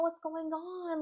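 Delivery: concerned friends repeating. Retold or imitated. Friends imitated pressing crying Dylan, high and worried. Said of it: "they were just like".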